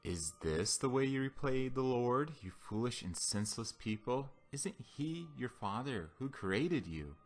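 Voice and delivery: ordinary delivery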